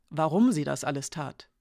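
plain reading voice

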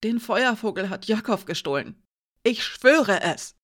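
pleading for belief; desperate oath. A narrator's reading. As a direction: swear it desperately, rising in force. Desperate oath under threat.

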